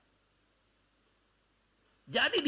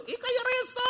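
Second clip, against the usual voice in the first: high startled grandma; 14 semitones higher, 4 dB louder, slower than usual. Retold or imitated. Quoting grandmother seeing ghost.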